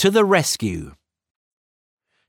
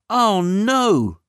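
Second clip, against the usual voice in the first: crying out; 7 semitones higher than usual. A narrator's reading. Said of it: "cried Minnie".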